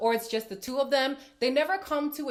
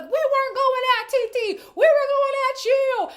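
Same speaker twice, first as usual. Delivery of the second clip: much higher and louder retort; mock group yell; 10 dB louder than usual. Imitating the girls shouting at Casey, loud retort. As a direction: yell it back as the villa. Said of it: "They're like".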